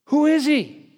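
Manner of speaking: high, loud astonished cry; amazed outcry. Retold or imitated. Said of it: "the people were asking".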